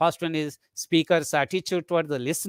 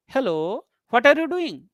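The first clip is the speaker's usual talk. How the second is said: "Hello" rising at usual pitch, then "what are you doing" jumps higher and falls; two tone groups; 6 semitones higher than usual. Shown as a demonstration. Teacher repeats the example sentence, "Hello" and "what are you doing" as separate tone groups. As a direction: pause briefly after "Hello", starting "what are you doing" afresh. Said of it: "you find two tone groups".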